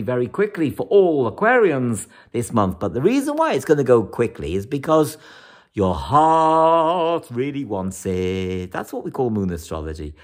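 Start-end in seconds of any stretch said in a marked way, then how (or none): 5.7-8.7 slow emphasis. Real, not acted. Stressing the heart's desire.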